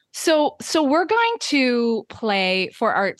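normal talking voice

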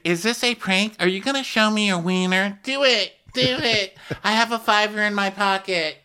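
very high mock-excited imitation; hammed-up high-pitched heckling; high goading voice. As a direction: egg him on in a squeaky put-on voice. Acting out Whitney goading the officer.

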